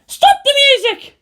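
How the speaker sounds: high, loud barked order; a girl's commanding shout. Acted. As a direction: order them to stop, full force. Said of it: "she commanded".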